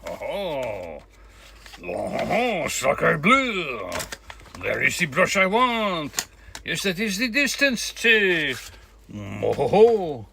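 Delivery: loud, theatrical French chef voice. Pretend. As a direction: boom it out like a proud French chef. Playful French exclamation, sacre bleu.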